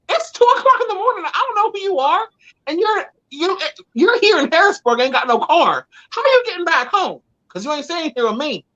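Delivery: high-pitched indignant disbelief; high disbelieving retort. Retold or imitated. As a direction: shoot him down in a high outraged squeal. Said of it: "And I was like".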